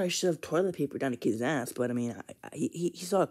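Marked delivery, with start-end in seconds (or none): none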